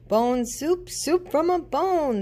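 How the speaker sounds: high, loud roared chant; loud monster roar chant; roaring crowd. A narrator's reading. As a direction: roar it as a hungry mob. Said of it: "they roared".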